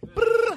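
high trilled brrr; imitated phone ring. Comic calling twenty people, mimicked phone ring.

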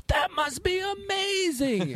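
very high, slow gushing fan; starstruck fan. Retold or imitated. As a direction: swoon like an awestruck fan. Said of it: "be like".

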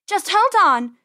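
high, loud, straining shout; urgent yell. A narrator's reading. Penny pulling her brother free, urgent yell. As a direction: yell it while tugging hard. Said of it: "Penny yelled".

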